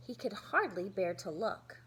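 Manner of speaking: ordinary delivery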